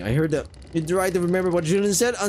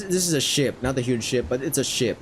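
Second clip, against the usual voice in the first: ordinary talk, nothing marked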